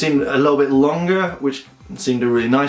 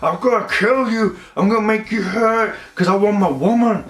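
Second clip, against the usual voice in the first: villain threat; 7 semitones higher than usual. Retold or imitated. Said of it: "he's like".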